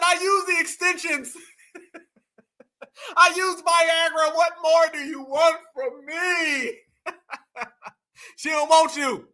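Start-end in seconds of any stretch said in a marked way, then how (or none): 0.0-1.4 high-pitched, hammed-up pleading; mock desperation. Mocking rejected man pleading. 3.2-6.8 high, slow, hammed-up pleading; mock desperate wail. Mocking rejected man pleading.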